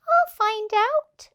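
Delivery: high, eager little voice; eager ladybird. Acted. Ladybird volunteering to the animals, high and eager. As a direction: pipe up brightly like a tiny ladybird. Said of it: "said the ladybird".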